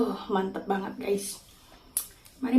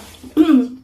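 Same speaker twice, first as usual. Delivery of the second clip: throat clearing; 5 semitones higher, 9 dB louder than usual. Real, not acted. After spicy bite.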